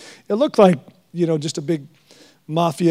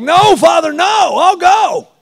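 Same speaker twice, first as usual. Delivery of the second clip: loud, high, slow pleading shout; Jesus shouting to the Father to send him; pleading cry; 10 semitones higher, 6 dB louder, slower than usual. Retold or imitated. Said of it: "Jesus was like".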